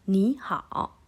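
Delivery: "ni hao" with "ni" rising, "hao" dipping; changed tone. Shown as a demonstration. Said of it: "ni kita baca nada dua".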